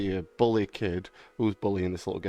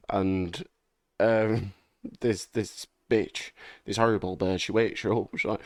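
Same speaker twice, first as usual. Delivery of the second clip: ordinary delivery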